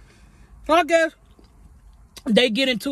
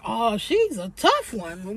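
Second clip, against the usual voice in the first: sarcastic; 4 dB quieter, slower than usual. Retold or imitated. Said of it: "in my head, I'm like".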